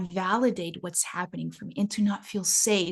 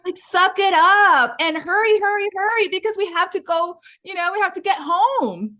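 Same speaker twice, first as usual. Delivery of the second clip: much higher, loud exasperated brush-off; hurried mom snapping at her daughter over ice cream; impatient scolding mom; 8 semitones higher, 4 dB louder than usual. Retold or imitated. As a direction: scold a crying child while in a hurry. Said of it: "my mom looked back and said".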